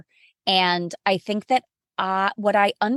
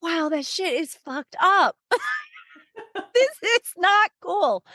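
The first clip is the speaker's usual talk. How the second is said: laughing complaint; 9 semitones higher than usual. Retold or imitated. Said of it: "in between laughing".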